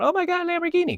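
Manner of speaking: very high-pitched imitation of a thrilled child; excited kid. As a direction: squeak it like an awestruck kid. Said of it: "these kids going".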